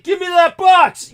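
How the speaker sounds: high, loud, slow demand; loud, slow, high shouted command; retold shout. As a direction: bark the order at the thief. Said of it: "everything I was shouting at that guy".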